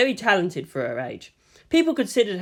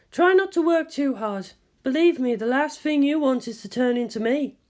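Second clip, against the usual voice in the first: higher, composed scientist voice; Ritsuko's voice; 9 semitones higher than usual. A narrator's reading. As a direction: speak as the measured senior scientist.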